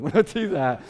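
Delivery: ordinary talk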